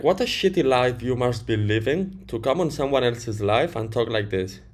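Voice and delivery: ordinary talk, nothing marked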